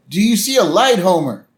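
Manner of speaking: high, calling preacher voice; Reverend Lovejoy. Retold or imitated. Host as reverend calling to lost Homer, calling out. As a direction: call out solemnly into the distance.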